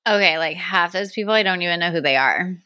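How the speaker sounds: normal talking voice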